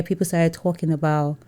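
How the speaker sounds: ordinary talk, nothing marked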